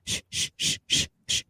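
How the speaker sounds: hushed unvoiced shushing of the car; imitated shushing. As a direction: shush everyone in a hiss of breath. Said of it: "telling everybody in the car".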